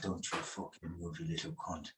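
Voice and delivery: put-on quiet, menacing Irish-accented threat. Man quoting his stepfather's threat at the fridge, hushed Irish accent. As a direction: imitate an abusive Irishman, voice dropped very low in volume. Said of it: "in exactly that accent".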